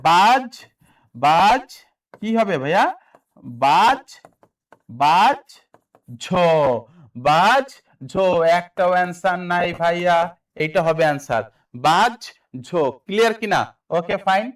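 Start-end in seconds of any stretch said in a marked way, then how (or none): 0.1-7.7 বাজ loud, rising, cut apart from ঝ; pronunciation demo. Shown as a demonstration. Teacher giving students the correct pronunciation, loud separated syllables. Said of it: "এই বাজ জোর উচ্চারণটা কি হবে?". 11.9-12.7 বাজ loud and rising, then ঝ apart; pronunciation demo. Shown as a demonstration. Teacher repeating the answer for students, loud separated syllables. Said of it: "এই বাজ জোর উচ্চারণটা কি হবে?".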